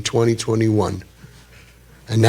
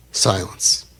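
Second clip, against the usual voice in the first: ordinary talk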